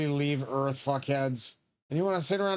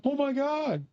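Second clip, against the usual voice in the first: father's shocked gasp; 11 semitones higher than usual. Retold or imitated. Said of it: "And then".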